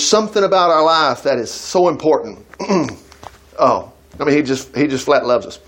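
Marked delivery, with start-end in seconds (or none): none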